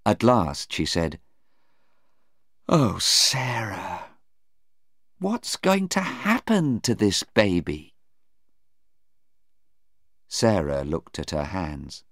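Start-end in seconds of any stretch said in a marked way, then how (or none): 2.7-7.9 drawn-out sigh of worry; lament, stretching the opening words; dismayed mother. Acted. Anna fearing for her daughter's baby, dismayed.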